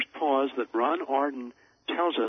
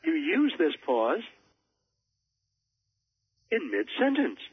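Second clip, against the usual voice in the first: long pause after pause, then in mid-sentence higher; 6 semitones higher, 4 dB louder than usual. Shown as a demonstration. Showing the mid-sentence pause to his interviewer. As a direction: stop dead in the middle of the sentence. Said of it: "as I just did for a third time".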